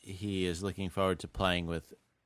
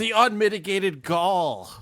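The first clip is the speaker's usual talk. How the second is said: high, slow, drawn-out offence; mock indignation; 11 semitones higher, 4 dB louder, slower than usual. Co-host feeding the ranting pundit his phrase, mock indignant. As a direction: proclaim it in playful outrage.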